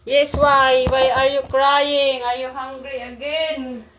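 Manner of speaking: real, loud parental fussing. Real, not acted. Calling to a crying baby.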